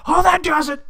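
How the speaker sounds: high and loud, fed-up snap; exasperated outburst. Acted. Dwarf losing it at the cheeky child, high angry yell. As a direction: boil over and give chase.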